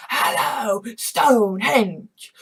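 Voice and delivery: slow, loud mock TV-broadcast call; dramatic announcement. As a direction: call it out like a sci-fi villain on air. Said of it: "Sorry, little Doctor Who joke".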